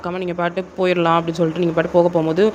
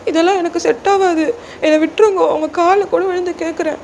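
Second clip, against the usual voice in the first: put-on high-pitched, pleading girl character; distressed junior girl; 11 semitones higher than usual. Junior girl begging senior to let her go, distressed pleading. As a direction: beg desperately in a high voice.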